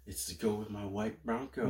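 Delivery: odd low put-on voice; lightly voiced celebrity impression; new put-on voice. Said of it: "What was that voice?".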